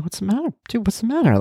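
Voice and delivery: put-on high, concerned plea to a crying child; worried comforting. Father soothing his weeping toddler, high and concerned. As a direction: ask him gently what's wrong, voice way up.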